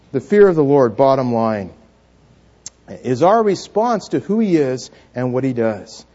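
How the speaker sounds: usual speaking voice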